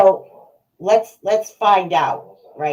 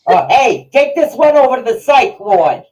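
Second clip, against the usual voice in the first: mocking dad; 6 semitones higher, 8 dB louder than usual.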